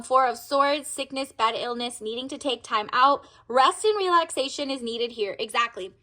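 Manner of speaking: normal talking voice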